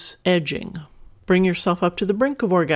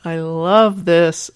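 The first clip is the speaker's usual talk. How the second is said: emphatic delight; 6 dB louder, much slower than usual. Real, not acted. Host delighted by listener email.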